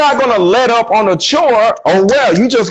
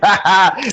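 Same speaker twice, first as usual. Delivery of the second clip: chuckle. Retold or imitated. Laughing on the phone scene.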